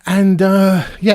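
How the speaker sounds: ordinary delivery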